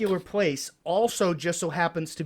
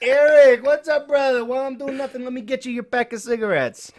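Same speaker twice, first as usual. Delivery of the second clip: high imitated greeting, loud at the start; exuberant shopkeeper; 9 semitones higher, 7 dB louder than usual. Deli man welcoming his regular, high exuberant imitation. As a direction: hail a favourite regular, then keep it high and chummy.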